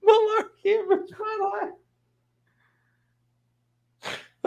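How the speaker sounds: high, squeezed words through laughing; helpless laughter. Real, not acted. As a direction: wheeze it out while cracking up. Cracking up at the joke title.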